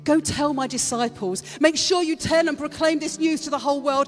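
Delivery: high, loud sermon delivery; fervent Easter preaching; passionate preaching. Acted. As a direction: drive the message home to the congregation. Preaching at full force.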